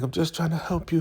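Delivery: high-pitched imitated excuse; mock protesting helper. Zane excusing herself to Elder Mother, high mock plea. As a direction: put on a high, earnest protest.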